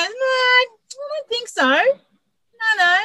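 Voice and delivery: high, slow, far-off mother's voice, unsure; slow and uncertain, as if hiding something; mother's reply. Retold or imitated. Mother on the phone denying she knows the name, high and slow.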